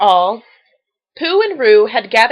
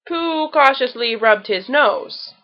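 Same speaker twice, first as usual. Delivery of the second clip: ordinary delivery, nothing marked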